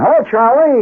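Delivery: high, overdone hello hollered across the room; hollered greeting. Retold or imitated. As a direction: sing out the greeting far too high. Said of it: "he hollers".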